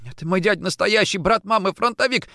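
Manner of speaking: high, strained, hurried protest; frightened babble. A narrator's reading. Orlov rubbing his throat, frightened babble. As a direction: blurt the excuse in panic. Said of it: "бормотал Орлов".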